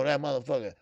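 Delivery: ordinary talk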